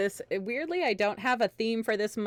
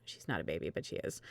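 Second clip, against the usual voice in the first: soft, whispered afterthought; hushed fond aside; 13 semitones lower, 11 dB quieter, faster than usual. Real, not acted. Player fondly whispering about her baby Kenku, hushed. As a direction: murmur it to yourself, fondly.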